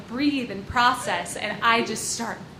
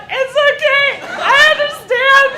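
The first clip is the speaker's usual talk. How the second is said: much higher, louder, laughing through it; laughing forgiveness; 11 dB louder than usual. Retold or imitated. Answering the mugger up the hill, laughing forgiveness. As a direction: forgive him while cracking up.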